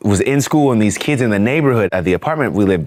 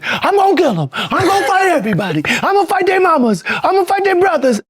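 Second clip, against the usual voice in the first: put-on much higher, worked-up child making threats; furious kid; faster than usual. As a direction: rant like a riled little kid. Riled-up kid, retold.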